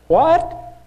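outraged disbelief; high, loud "what?"; shocked. Real, not acted. Shocked at Easter in Acts.

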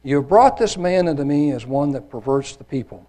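measured, emphatic Bible reading; scripture reading. A narrator's reading. Preacher reads the trial before Pilate, emphatic scripture reading. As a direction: read it out with solemn weight and stressed key words.

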